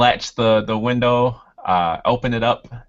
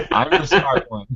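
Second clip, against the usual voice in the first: higher-pitched, amused protest; mock indignation; 5 semitones higher than usual. Player joking as his outwitted character, mock indignant. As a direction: insist on it through the amusement.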